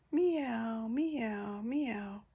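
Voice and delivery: sighing kittens. A narrator's reading. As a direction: let each meow fade to almost nothing. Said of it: "They began to sigh".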